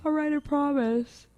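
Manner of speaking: high, slow retold giving-in; reluctant promise. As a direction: drag out a grudging promise. Reluctant promise to insistent mom.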